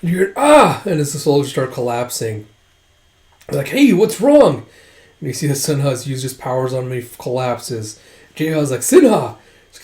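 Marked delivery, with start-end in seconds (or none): 0.4-0.8 loud, high "ah" of alarm; short retold scream; mock soldier's yell; acted cry. 3.7-4.7 loud, high startled question; retold shout of alarm; acted alarm. Alarm at collapsing soldiers. 8.8-9.4 loud, high name cry; retold shout of alarm; acted alarmed call. Calls to collapsing friend.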